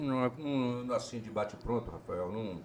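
talking between bites; chewing while talking. Real, not acted. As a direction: mouth full of food. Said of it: "Fica comendo e falando".

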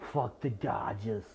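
slow, put-on Chicago accent; shaky stab at a Chicago accent; bad Chicago accent. Shown as a demonstration. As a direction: attempt a rough Chicago accent, drawn out. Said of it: "try to say in a fucking shitty ass Chicago accent".

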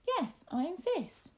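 put-on high, drawn-out boyish insistence; insistent young master. Young master orders his companion to go on, high and slow. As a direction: insist like a little lord.

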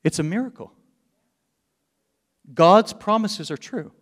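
preacher rising to a loud climax; full-force preaching. Real, not acted. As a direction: drive the truth home to the congregation.

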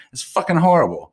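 high, loud burst of exasperation on horrible; exasperated outburst. Real, not acted. Ranting about people who whisper on calls, exasperated high loud outburst. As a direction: blow up at the whisperers, pitch jumping high.

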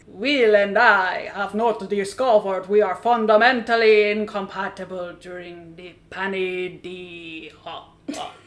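slow, loud Dracula with a laugh tailing off; hammed-up vampire counting blessings. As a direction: boom it out like a Count from Transylvania. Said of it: "The Count?".

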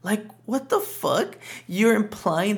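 high, slow, squeaky disbelief; incredulous. Real, not acted. Podcaster reacts to the mother's weird remark, incredulous. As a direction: stretch it out, utterly baffled.

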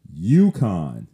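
a loud, falling UConn; emphatic UConn. Shown as a demonstration. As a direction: hit UConn hard on its own. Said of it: "Why do I emphasize".

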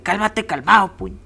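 hammed-up wizard telling the demon to chill; mock calming wizard. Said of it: "Algo así como que". (voz aguda de burla, como mago calmando al demonio)

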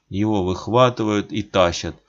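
plain reading voice

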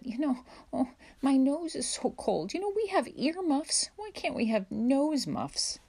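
put-on high-pitched child's grumble; complaining child. A narrator's reading. Alice to her brother about her frozen nose, complaining.

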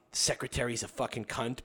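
ordinary delivery

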